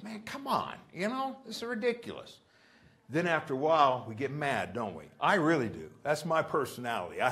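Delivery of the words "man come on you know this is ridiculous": higher, softer fed-up groan; a touch of exasperation; mock frustration. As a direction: play someone at the end of their rope. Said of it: "you get frustrated".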